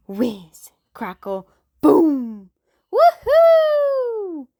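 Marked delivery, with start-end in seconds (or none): 1.8-2.5 much louder burst; booming. A narrator's reading. Firework sound word. 2.9-4.5 high, loud crowd whoop; cheering. Acted. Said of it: "cheered the crowd".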